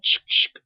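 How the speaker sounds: loud whispery shutter clicks; sharp voiceless "chick, chick"; imitated camera shutter. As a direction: mimic a phone camera snapping twice. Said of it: "that little camera sound".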